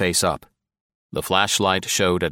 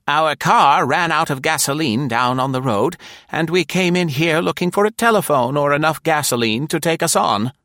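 higher, louder matron, steady and firm; firm grande dame; 9 semitones higher, 4 dB louder than usual. A narrator's reading. Nora explaining to the armed stranger, firm and steady. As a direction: state it with no quaver at all. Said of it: "she said firmly".

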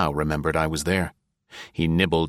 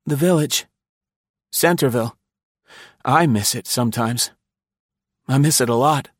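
high, wistful question; old spy longing for the village; wistful Densham; 8 semitones higher, 4 dB louder than usual. A narrator's reading. Densham nostalgic.

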